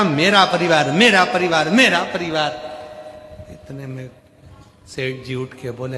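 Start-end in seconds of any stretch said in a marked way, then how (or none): 0.0-2.5 mock cry. Mocking man's repeated cry.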